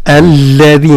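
الذين with the lam held doubled. Shown as a demonstration. Teacher showing the doubled lam. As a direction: lean on the shadda in الذين. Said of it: "الذين هكذا".

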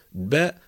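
"باء" as "ba", released from closed lips; voweled consonant. Shown as a demonstration. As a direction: burst from b into an open a on "باء". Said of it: "تنطلق من التصاق الشفتين". (نطق "باء" مفتوحة تنطلق من التصاق الشفتين)

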